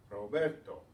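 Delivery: "Roberto" with a loud, stressed "ber"; stress on next-to-last syllable. Shown as a demonstration. Teacher models stress for students, "Roberto" stressed on "ber". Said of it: "stressed on the next to the last syllable".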